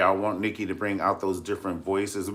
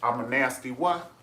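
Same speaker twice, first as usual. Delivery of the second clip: a hint of Madea, rising on what; lightly voiced indignant Madea comeback; Madea style; 6 semitones higher than usual. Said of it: "good old Madea style".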